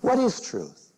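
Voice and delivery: high, dismissive shrug of a question; cynical Pilate. Retold or imitated. Educated Pilate brushing off Jesus, cynical and high. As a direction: toss it off like a jaded skeptic. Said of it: "Pilate probably learned that question".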